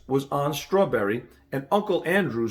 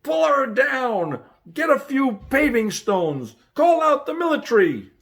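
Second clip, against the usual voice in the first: angry crowd roar; 10 semitones higher, 4 dB louder than usual. A narrator's reading. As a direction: bellow it like a furious crowd. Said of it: "an angry roar from the crowd".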